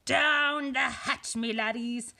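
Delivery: long, loud mealtime bellow; pirate yell. A narrator's reading. Captain Braidbeard calls crew to eat, yelling. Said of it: "pounded his fist on the table and yelled".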